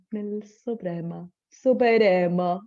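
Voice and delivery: very slow, drawn-out posh ad voice; tea ad voice. Retold or imitated. Mocking the Té Supremo ad announcer. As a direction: stretch "Supremo" like the tea commercial. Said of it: "hablan como el del té supremo".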